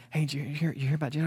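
hushed middle-school gossip about Jesus. Retold or imitated. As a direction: lean in and pass on the secret quietly. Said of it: "So there's all this hushed".

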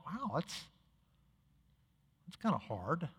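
inner thought. Retold or imitated. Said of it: "And I thought".

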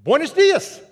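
high loud Buenos dias; beaming greeting. Retold or imitated. Hotel attendant greeting guests, beaming and loud. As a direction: sing it out with a big smile. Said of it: "lit up".

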